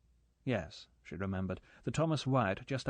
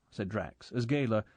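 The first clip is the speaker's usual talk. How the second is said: ordinary delivery, nothing marked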